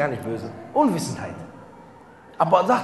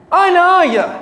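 emphatic; 10 semitones higher, 9 dB louder than usual. Acted. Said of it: "Einfach, Achi, so kurz".

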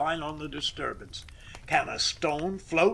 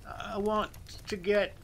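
higher, hesitant voice losing its nerve; tremulous, trailing off; 5 semitones higher than usual. A narrator's reading. Timid worker begins his request, tremulous and trailing off. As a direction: falter and let the last word die away. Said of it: "trailed off into tremulous, quavering incoherency".

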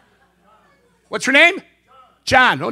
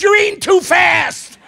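high, slow shout down the length of the body; a slow, high, loud call to his partner; shouted complaint; 9 semitones higher, 6 dB louder, slower than usual. Acted. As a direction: yell it from the other end, drawn out. Calls out from far end.

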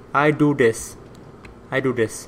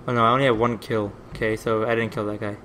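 ordinary talk, nothing marked

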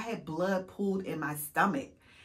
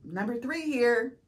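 sarcastic self; 9 semitones higher, slower than usual. Retold or imitated. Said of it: "And I'm like".

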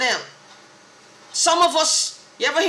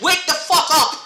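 angry shout; 3 semitones higher, 4 dB louder than usual. Real, not acted. Ranting at the government.

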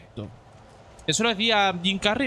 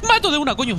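louder, higher excited yell; excited shout; 6 semitones higher, 6 dB louder, faster than usual. Real, not acted. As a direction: shout the win, pumped up. Repite más alto. (grito de emoción)

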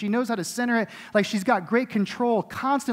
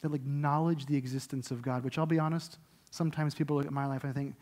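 much softer, low, intimate sermon voice; tender quiet preaching; 4 semitones lower, 7 dB quieter than usual. Acted. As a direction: speak it close, almost to one person.

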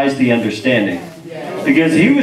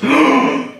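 imitated huge intake of breath; sudden deep breath in; deep gasp; 11 semitones higher than usual. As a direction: gasp in deeply like lungs suddenly filling. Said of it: "takes this deep breath and goes".

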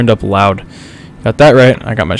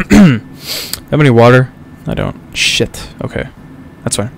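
normal talking voice

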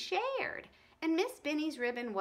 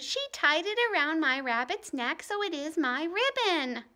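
put-on upset girl; 5 semitones higher, 6 dB louder than usual. A narrator's reading. Ramona pleads.

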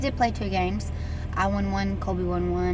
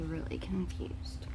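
usual speaking voice

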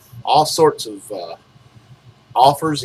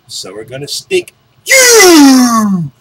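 mock outrage; 9 semitones higher, 10 dB louder, slower than usual. Mock-outraged rate increase.

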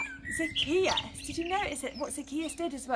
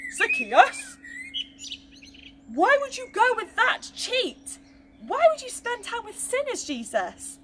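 higher, louder indignant crowd cry; crowd shouting; 12 semitones higher, 6 dB louder than usual. Acted. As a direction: call it out like an angry crowd. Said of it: "The crowd is now shouting".

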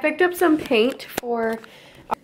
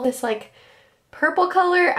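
amused disbelief; 8 semitones higher than usual. Real, not acted. Amused disbelief at purple paint.

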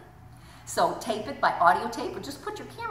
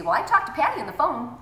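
indignant; 6 semitones higher, 5 dB louder than usual. Retold or imitated. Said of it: "they say".

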